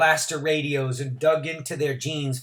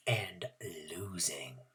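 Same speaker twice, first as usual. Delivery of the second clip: whisper; 7 semitones lower, 13 dB quieter, slower than usual. A narrator's reading. Narrator's hushed ending.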